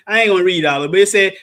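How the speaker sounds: normal talking voice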